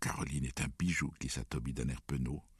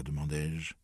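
plain reading voice

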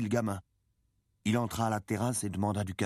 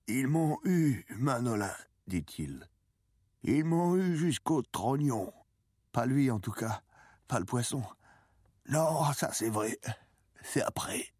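put-on old fisherman, pitched up and drawn out; defeated old man; 7 semitones higher than usual. A narrator's reading. Old man confessing his defeat to the boy, lamenting. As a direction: lament the defeat with stretched words.

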